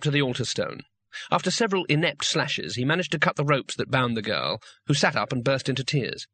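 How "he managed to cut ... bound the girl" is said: plain reading voice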